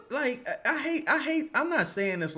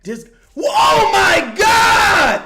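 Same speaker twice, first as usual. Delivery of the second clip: stretched, much higher, louder wail of disbelief; anguished shout; 16 dB louder, much slower than usual. Real, not acted. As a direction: cry out at yet another miss. Exasperated at missed shots.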